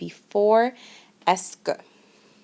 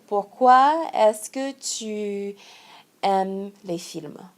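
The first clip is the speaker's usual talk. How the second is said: slow example; much slower than usual. Shown as a demonstration. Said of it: "for example".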